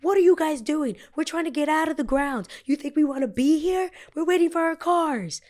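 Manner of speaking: high, loud outraged pleading; high, loud, upset shouting about the push; shouting at the police. Retold or imitated. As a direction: yell your case at the officers. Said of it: "is me saying them".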